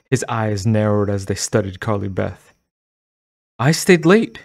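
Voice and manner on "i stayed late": ordinary delivery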